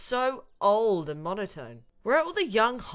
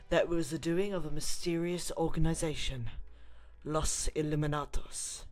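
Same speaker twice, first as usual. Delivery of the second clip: dramatic narration; 3 semitones lower, 5 dB louder than usual. Acted. Gamer narrating the intro as a dramatic story. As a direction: read it like a movie trailer intro.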